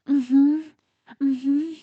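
loud rising hums, mouth full; muffled moaning. Acted. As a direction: moan around a mouthful. Sounds during oral sex.

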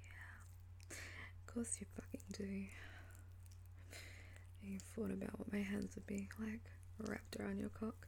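intimate, teasing whisper; sultry voice. Acted. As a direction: whisper it close and seductive. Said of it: "you like my sultry voice".

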